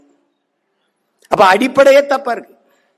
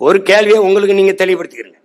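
ordinary talk

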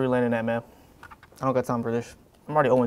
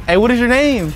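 loud; 7 semitones higher, 10 dB louder than usual. Acted. Pretends not to hear, shouting.

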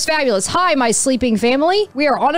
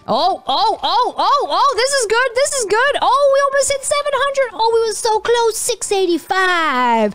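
high squeals, easing at the score; breathless-sounding high-pitched thrill; mounting excitement; 10 semitones higher than usual. Real, not acted. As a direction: squeal as the record gets closer. Jump nears record score.

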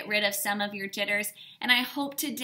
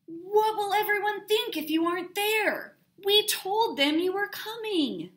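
a higher, louder dad pleading with her; 11 semitones higher, 5 dB louder than usual. A narrator's reading. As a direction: reason with a stubborn child. Dad reasons with Sarah.

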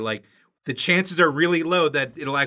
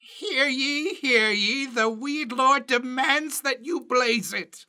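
slow, higher mock proclamation; royal herald; 9 semitones higher, slower than usual. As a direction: announce like a pompous town crier.

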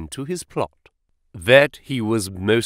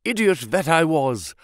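higher-pitched excited exclamation; excited self-reproach; 4 semitones higher than usual. A narrator's reading. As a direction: scold yourself in sudden excitement. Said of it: "yelled Holmes".

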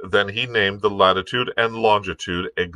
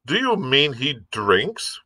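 mild cry; 8 semitones higher, slower than usual. A narrator's reading. Squire questions the captain about the mate, mild high cry. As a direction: draw out a mildly shocked question. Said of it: "cried the squire".

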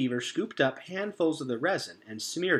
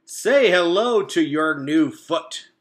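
slow, loud, booming beaver announcement; proud beaver; 5 semitones higher, 11 dB louder, slower than usual. A narrator's reading. Mister Beaver presenting the new foot, slow proud boom. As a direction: present the wooden foot with pride. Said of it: "he said, slapping the wood".

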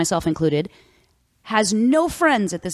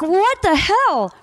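high, slow disbelieving what the hell; mock outrage; 10 semitones higher, much slower than usual. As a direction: stretch it out in disbelief. Shocked at agent's order.

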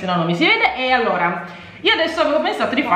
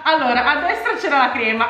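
ordinary talk, nothing marked

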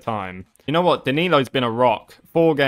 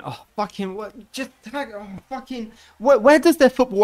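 exasperated disbelief; 8 semitones higher than usual. Real, not acted. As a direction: sigh out the frustration. Player misses tackle.

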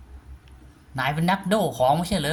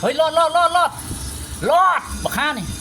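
panicked urging; 6 semitones higher than usual. Real, not acted. Fleeing the monster in panic.

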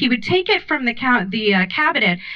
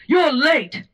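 a hint of an old-timey haughty voice; clipped old-timey rebuke; old-timey Charlotte; 5 semitones higher than usual. Charlotte at the door scolding latecomers, old-timey. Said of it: "old-timey voice".

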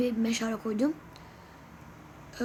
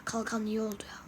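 whisper; 4 semitones lower, 8 dB quieter than usual. Real, not acted. Muttering to himself about shield.

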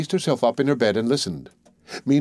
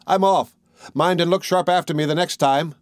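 high-pitched taunting send-off; cheeky little man; 6 semitones higher than usual. A narrator's reading. Tom teases the men as he escapes, cheeky. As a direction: pitch it up, a small man teasing.

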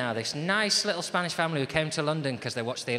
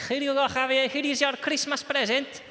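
loud, higher Spanish dad voice; Spanish accent; 12 semitones higher, 5 dB louder than usual. Acted. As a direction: present the gift proudly in a Spanish accent. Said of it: "we'll get back into character now".

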